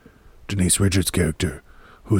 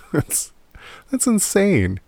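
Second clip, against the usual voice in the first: pitched way up, amazed; incredulous; 12 semitones higher, slower than usual. Real, not acted. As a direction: exclaim in disbelief. Disbelief at story.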